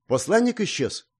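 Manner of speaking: high-pitched cry of surprise; surprised exclamation. A narrator's reading. Novice sees the messenger vanish, surprised. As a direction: exclaim in startled wonder. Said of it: "удивлённо воскликнул послушник".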